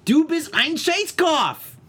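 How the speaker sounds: high, slow mock-German insult; bad German accent. Host cussing at his friend in German, bad accent. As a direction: proclaim it in hammed-up German. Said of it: "If I do a German accent".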